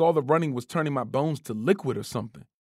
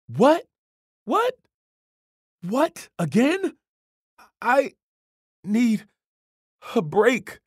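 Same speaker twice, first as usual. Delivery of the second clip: panting protest; 9 semitones higher, 4 dB louder, much slower than usual. A narrator's reading. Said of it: "I panted".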